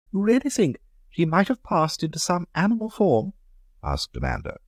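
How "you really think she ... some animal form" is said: high, light society lady asking; a much higher lady's voice, curious; Amanda's voice. Acted. Amanda asks, voiced by narrator.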